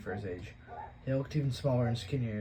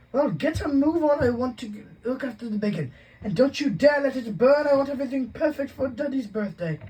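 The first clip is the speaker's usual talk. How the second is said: Aunt Petunia bossing; 10 dB louder than usual. A narrator's reading. Aunt ordering the boy to mind the bacon, high and loud. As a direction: nag like a fussy aunt.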